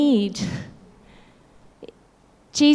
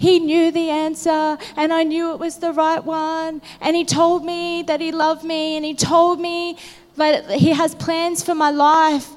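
higher, mildly imitating a weeping boy; a touch of a crying child, pitch lifted; son's tearful words; 5 semitones higher than usual. Retelling her son's vision, lightly tearful and higher. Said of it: "he just started bawling".

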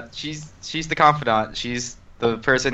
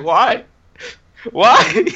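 climbing higher, louder wailed why; mock anguished wail; 5 dB louder, slower than usual. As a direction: wail in mock grief, slowing at the name. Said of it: "and I'm just like".

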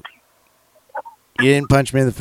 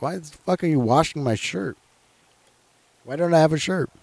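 incredulous questioning; 7 semitones higher than usual. Retold or imitated. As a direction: question him in disbelief, pitch rising. Retelling bafflement about washed shirt.

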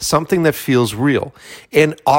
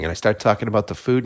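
normal talking voice

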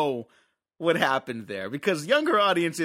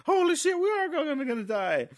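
high, panicked mock yelp; 14 semitones higher than usual. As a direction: squeal it like you're about to die. Tarantino panicking in retold scene.

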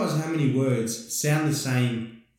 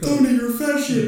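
high, loud mock taunt; shouted film line, pitched way up; film-quote impression; 11 semitones higher, 5 dB louder than usual. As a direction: put on a high, loud movie-character voice. Quoting a film character.

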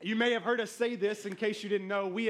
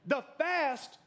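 put-on pitched-up, louder kid's "duh"; mock exasperated child; 7 semitones higher, 4 dB louder, much slower than usual. Daughter reminding her forgetful dad of the fast, mock exasperation. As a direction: state the obvious like a fed-up kid. Imitating daughter stating the obvious.

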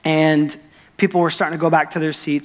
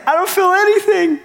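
much higher, louder voice of wonder; the healed boy telling the pastor, amazed and breathless; amazed disbelief; 4 dB louder than usual. Retold or imitated. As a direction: squeak it out in astonished delight. Boy finds ankle pain gone.

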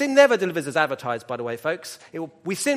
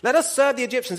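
pleading outcry; 7 semitones higher, 4 dB louder than usual. Retold or imitated. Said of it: "Didn't we say to you in Egypt".

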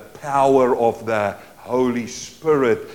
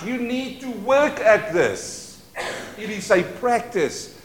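ordinary delivery

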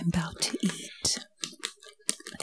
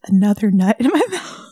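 laughing; 2 semitones higher, 10 dB louder than usual. Real, not acted. Laughs mid ASMR line.

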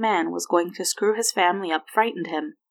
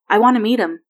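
sudden loud, higher outburst; blurted out; 4 semitones higher, 10 dB louder than usual. A narrator's reading. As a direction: burst out with it, much louder. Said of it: "Will blurted out".

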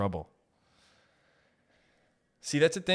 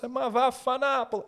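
Italian curse; 13 semitones higher than usual. Acted.

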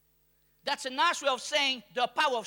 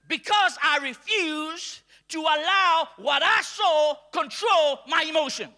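slow, loud shouted declaration; defiant shout; 5 dB louder, slower than usual. Acted. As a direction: hammer each word home.

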